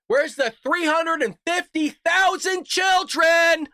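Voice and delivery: loud, slow, outraged demand; furious shouting demand; angry shout. Real, not acted. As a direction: yell the question at the screen. Ranting at debate moderators.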